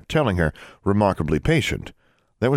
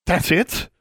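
much higher, indignant demand; 12 semitones higher than usual. Acted. Daughter demanding answers from her father, indignant. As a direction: challenge him outright. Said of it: "Alexandra demanded".

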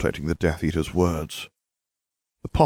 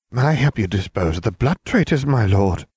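eager plea; 5 semitones higher, 5 dB louder than usual. A narrator's reading. Bellatrix begging eagerly.

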